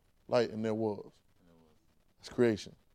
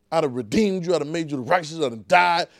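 normal talking voice

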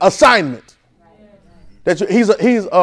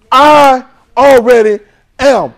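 firm declaration; 5 semitones higher, 5 dB louder, much slower than usual. Shown as a demonstration. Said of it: "Say it like you believe it".

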